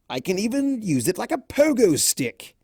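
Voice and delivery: a hint of a vain showman, playful; lightly voiced boastful Discord. A narrator's reading. Discord showing off his tail to Fluttershy, lightly voiced and smug. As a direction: preen and brag with a grin in the voice.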